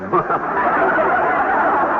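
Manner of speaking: short airy laugh; breathy laugh. Acted. Husband laughing at wife's jab, short airy laugh.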